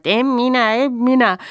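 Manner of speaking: high, thin-pitched insect chorus; crying mosquitoes. A narrator's reading. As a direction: cry it out high like swarming mosquitoes.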